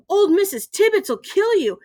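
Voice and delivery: put-on high, excited child's warning; warning kid. A narrator's reading. Kid warns Ben about Missus Tibbets, excited. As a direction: warn him he is doomed.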